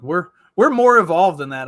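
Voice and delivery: loud, lilting mock insistence; imitated protest. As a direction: argue it loudly like an indignant friend. Quoting a friend's naive argument.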